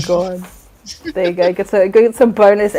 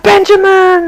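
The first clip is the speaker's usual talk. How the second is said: excited greeting shout; 13 semitones higher, 9 dB louder, slower than usual. Retold or imitated. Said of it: "He's like".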